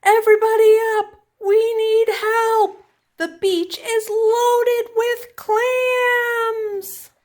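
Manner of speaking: high, loud calling out across the yard; a boy's excited yell, stretched out long. Acted. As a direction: shout to wake the whole house. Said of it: "He yelled from the backyard".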